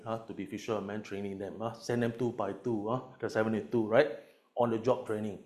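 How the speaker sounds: ordinary delivery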